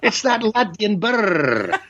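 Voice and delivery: slow, rolled Sean Connery-style Scottish burr, lightly put on; lightly hammed Scottish accent passed off as Latvian; mock Scottish burr. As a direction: drag it out in a teasing Scottish accent. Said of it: "talks with his bear and everything".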